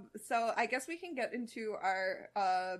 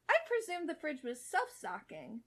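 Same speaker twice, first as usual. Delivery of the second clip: confident Starfire; 6 semitones higher than usual. Acted. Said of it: "Starfire admitted with full confidence".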